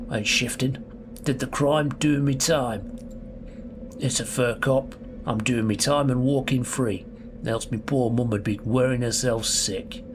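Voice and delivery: London prisoner's voice, steady and matter-of-fact; Londoner accent. Acted. Gamer voicing a jailed thief in a London accent. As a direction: play a Londoner doing his time. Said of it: "like, Londoner".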